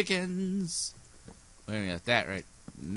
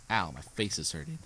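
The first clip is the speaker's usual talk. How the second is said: ordinary delivery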